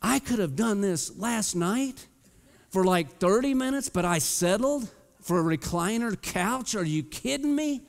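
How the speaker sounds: climbing to a high squeal of disbelief; incredulous excitement. Retold or imitated. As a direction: kick yourself for settling, amazed. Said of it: "I'm like".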